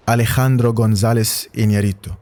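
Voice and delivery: name said with a Spanish accent; Spanish pronunciation of the name. Shown as a demonstration. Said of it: "in this Spanish accent".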